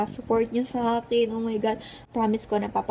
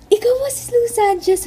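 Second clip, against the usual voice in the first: excited fan voice; 11 semitones higher, 5 dB louder than usual. Retold or imitated. Fan asks excitedly in retold encounter.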